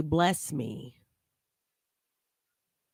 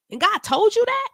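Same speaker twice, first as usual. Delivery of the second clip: much higher and louder, disbelieving; incredulous; 7 dB louder than usual. Real, not acted. Vlogger challenges the preacher's claim, high and loud in disbelief. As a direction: throw the question back in total disbelief.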